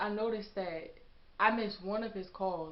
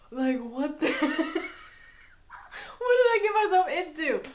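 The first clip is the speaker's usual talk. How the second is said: laughing disbelief; 9 semitones higher, 10 dB louder than usual. Real, not acted. Panicked outburst during arrest scene.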